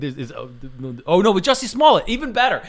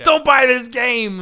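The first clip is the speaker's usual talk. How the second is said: high, drawn-out mock outrage yell; mock hysterical shout; 11 semitones higher, 8 dB louder, slower than usual. Host mimicking angry reviewers of a game, hammed-up hysterical shouting. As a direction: bellow it like a hammed-up angry mob.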